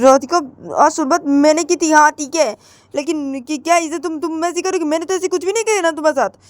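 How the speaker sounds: whining that she never did this; hurt complaint. Acted. Krishna complaining to Radhika, high and aggrieved.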